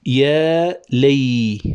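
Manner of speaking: very slow, stretched syllables; slowly chanted metrical scansion; scanning syllables. Shown as a demonstration. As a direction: draw out each syllable to scan the meter. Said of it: "نكتب كما نلفظ".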